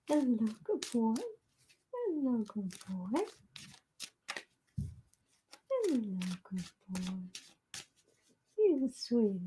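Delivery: soft cooing to a dog; pet talk. Real, not acted.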